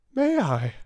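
put-on girl exclaiming with eager hope; eager exclamation. A narrator's reading. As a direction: blurt out with sudden hope. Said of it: "exclaimed Sarah".

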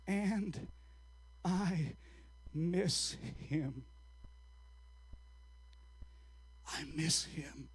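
halting, hushed confession, long pauses between words; trembling voice. Retold or imitated. Old man admitting he misses Jesus, trembling and hushed. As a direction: let it out softly and slowly, as if close to tears. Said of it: "with a trembling voice".

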